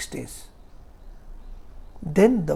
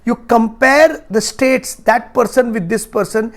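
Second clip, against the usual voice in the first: forceful preaching; 4 semitones higher, 10 dB louder than usual. Acted. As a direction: drive each point home to the hall. Teaching at full force.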